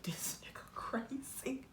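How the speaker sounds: slow, barely voiced aside; hushed, drawn-out horror; stunned whisper. Stunned aside after the shouting.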